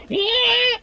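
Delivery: very high imitated squeal; high-pitched screech in imitation; mock screaming. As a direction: let out one long mock shriek. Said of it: "starts, like, screaming".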